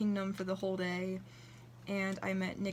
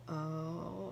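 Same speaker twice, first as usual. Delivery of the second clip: guilty oh; 4 semitones lower, 5 dB quieter than usual. Retold or imitated. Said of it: "he looked all guilty".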